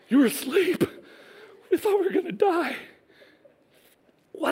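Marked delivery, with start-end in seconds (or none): 0.1-2.8 high, small, mock-timid voice; meek excuse. Peter explaining his panic to Jesus, meek high voice. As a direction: squeak it out sheepishly.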